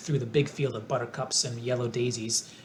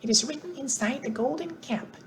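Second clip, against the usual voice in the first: squeaky mouse queen; 11 semitones higher than usual. A narrator's reading. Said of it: "replied the Queen of the Mice".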